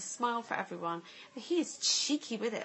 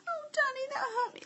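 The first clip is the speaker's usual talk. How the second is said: much higher mock whimper; pretend hurt. Mum pretending to cry after being hit, much higher. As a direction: fake being hurt to the toddler. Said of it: "pretend to cry".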